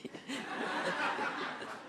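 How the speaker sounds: airy emotional giggle; breathless laugh. Real, not acted. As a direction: laugh out the nerves. Nervous laugh accepting award.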